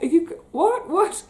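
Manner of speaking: high, incredulous yelps; as if you can't believe the gift; stunned disbelief. Retold or imitated. Bookseller reacting to the author's free books, incredulous. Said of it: "It's like".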